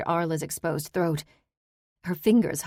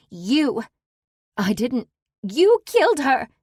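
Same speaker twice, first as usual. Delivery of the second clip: much higher, loud shrieked blame; shrieking accusation; 8 semitones higher, 7 dB louder than usual. Acted. Daughter accuses the ex-convict, shrieking loud and high. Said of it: "she shrieked".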